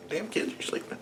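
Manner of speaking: whispered, clipped scold; angry whisper. Retold or imitated. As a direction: hiss it so the kids stay asleep. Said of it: "me getting all angry".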